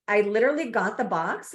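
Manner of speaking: usual speaking voice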